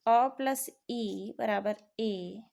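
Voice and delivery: spelled out slowly, each sound apart; slow and deliberate, leaning on अ; slow dictation. Shown as a demonstration. Teacher slowly dictating the vowel sum to students. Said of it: "जैसे अ".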